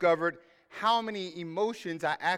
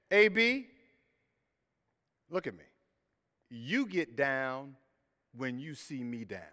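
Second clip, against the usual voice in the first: mentor's quiet words; 5 semitones lower, 6 dB quieter than usual. Retold or imitated. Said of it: "He said".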